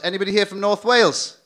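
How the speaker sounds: rally the room; calling out. Real, not acted.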